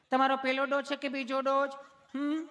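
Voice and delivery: high put-on nurse voice; sweetly asking nurse. Nurse asking why he is nervous, high mock sweetness. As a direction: coo questions up high at the patient. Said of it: "તમે કેટલું પ્રેમથી પૂછો છો".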